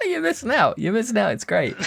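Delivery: giggly high tease; teasing laugh. Real, not acted. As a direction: rub it in playfully. Teasing friend who hasn't played.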